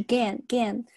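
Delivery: "gan" with the open vowel of cat; a clear model "gan", rhyming with "can". Shown as a demonstration. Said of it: "它不是gun的这个音".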